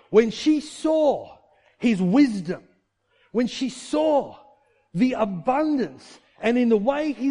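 slow, loud preaching; preaching at full force. Acted. Preacher driving the point home to the church, slow and loud. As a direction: drive it home from the pulpit.

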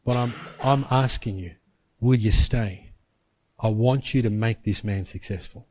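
low, soft, patient appeal; voice of the Spirit. Retold or imitated. Holy Spirit leaving Paul the choice, low gentle appeal. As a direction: ask gently without pushing, voice kept low. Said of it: "the Holy Spirit's saying".

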